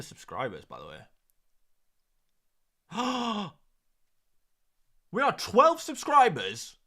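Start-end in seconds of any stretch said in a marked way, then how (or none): 2.9-3.5 high gasp of surprise; sharp gasp. Real, not acted. Streamer checking the subscriber count, gasping. 5.1-6.8 high, loud burst of excitement; excited announcement. Real, not acted. Streamer telling viewers the subscriber count, excitedly.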